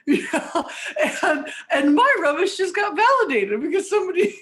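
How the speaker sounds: talking through laughter, high and quick; real amused laughter breaking into the words; laughing. Real, not acted. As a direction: laugh your way through the absurd point. Laughing at her own absurd example.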